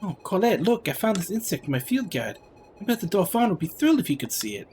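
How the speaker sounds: high-pitched, delighted innocent; wide-eyed game character. Acted. Companion showing Colette the peculiar flowers, high and delighted. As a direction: coo over a discovery in a high voice.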